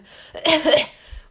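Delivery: loud acted cough. A narrator's reading. Anne just splashed into the moat, coughing hard. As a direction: cough up water in a loud fit. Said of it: "und hustete".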